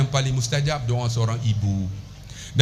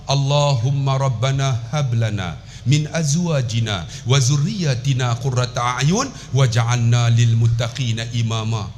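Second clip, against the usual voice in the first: slow, lower recited prayer; prayer recitation; 6 semitones lower, slower than usual. Acted. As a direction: recite the dua slowly. Recites Quranic prayer slowly.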